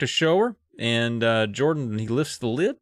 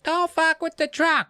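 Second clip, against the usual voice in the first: high-pitched mock kids' chorus; kids repeating. Kids dutifully repeat Dad's trunk rule, high mocking chant. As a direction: parrot it back in a squeaky kid voice.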